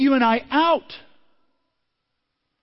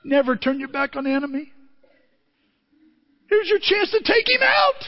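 high exasperated shout at the hero; frustrated high shout at a film; yelling at the screen; 12 semitones higher than usual. Retold or imitated. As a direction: scold the hero at full voice. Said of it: "I'm like".

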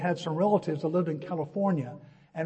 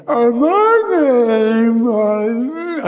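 loud, drawn-out starstruck garble; hammed-up stammer, dragging each word out loudly; mock tongue-tied; 5 semitones higher, 8 dB louder, much slower than usual. Starstruck fan asked his name by a girl, mock tongue-tied. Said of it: "I could not speak".

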